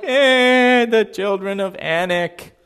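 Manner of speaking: slow, dragged mock grumble; mocking dismissive whine. The doubting Israelites mocked with a dragged grumble. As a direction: sneer out the excuse. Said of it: "Everybody else was like".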